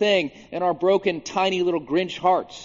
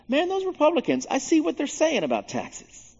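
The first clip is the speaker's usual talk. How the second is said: very high, mock-agreeable pundit voice; MSNBC; 12 semitones higher than usual. Imagined cable news siding with Republicans, in mock high voice. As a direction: leap up high, conceding the rival's point. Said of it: "M S N B C would be like".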